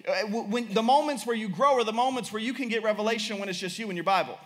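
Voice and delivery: high-pitched, driving sermon delivery; impassioned preaching. Real, not acted. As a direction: preach it urgently, pressing each point home.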